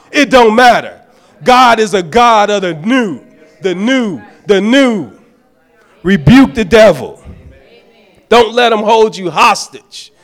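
loud, rising preacher's exhortation; full-force preaching. Real, not acted. Preacher urging the congregation to let go, full force. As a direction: preach it hard to the saints.

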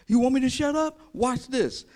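high, loud dare; defiant challenge. Acted. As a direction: throw it back at those hushing you. Acting blind man defying the crowd.

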